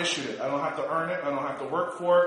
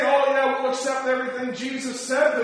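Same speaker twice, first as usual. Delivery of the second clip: mocking; 6 semitones higher than usual. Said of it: "And so they say".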